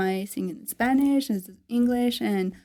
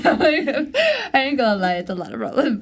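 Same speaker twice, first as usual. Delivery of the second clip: laughing; 4 semitones higher, 3 dB louder than usual. Real, not acted. Laughing about her problems.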